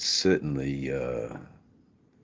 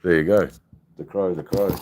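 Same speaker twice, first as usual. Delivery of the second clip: calling out; 2 semitones higher, 7 dB louder than usual. Real, not acted. Calling to the crow.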